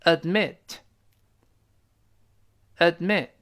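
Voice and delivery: very slow "admit", split after "ad", final t released; careful slow-motion "admit" with a crisp t; slowly. Shown as a demonstration. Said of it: "慢慢来".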